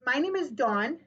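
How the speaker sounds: ordinary talk, nothing marked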